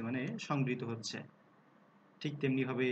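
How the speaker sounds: ordinary talk, nothing marked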